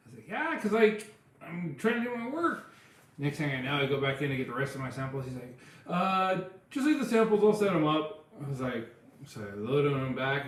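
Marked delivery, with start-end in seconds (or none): 0.3-2.7 upset complaint. Retold or imitated. Quoting herself upset to CEO. 5.9-8.2 sheepish backing down. Retold or imitated. Quoting the man backing down.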